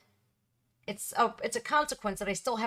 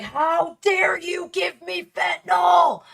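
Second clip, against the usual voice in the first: furious shout, much higher and louder; screaming in rage; 9 semitones higher, 7 dB louder, slower than usual. Retold or imitated. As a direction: scream it at the top of your lungs in fury. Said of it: "I screamed at the top of my lungs".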